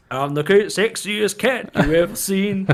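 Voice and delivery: put-on voice of an uncle showing off; uncle's recorded voice. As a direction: imitate the uncle on tape, loud and slow. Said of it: "he plays his uncle's recording".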